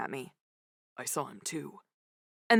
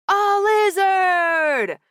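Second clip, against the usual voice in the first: crowd shout; 12 semitones higher, 8 dB louder, much slower than usual. A narrator's reading.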